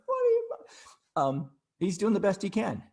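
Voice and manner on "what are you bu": high-pitched squawk of an irate driver; imitating a driver yelling at him, much higher; mock angry driver. Driver yelling as he cuts past, high mock shout. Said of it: "he goes by like".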